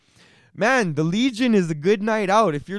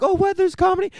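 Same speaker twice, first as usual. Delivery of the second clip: surprised; 13 semitones higher, faster than usual. Retold or imitated. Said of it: "they're like".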